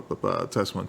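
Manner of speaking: ordinary delivery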